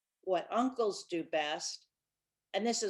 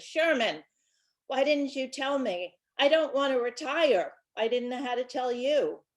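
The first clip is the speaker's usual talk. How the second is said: louder, higher father voice bursting out; a father finally admits he never wanted to be chief; father's loud relief; 5 semitones higher, 6 dB louder than usual. Acted. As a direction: blurt it out in relief. Father confesses he wants to retire.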